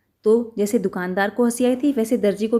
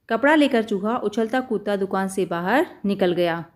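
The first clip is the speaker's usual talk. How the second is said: ordinary delivery, nothing marked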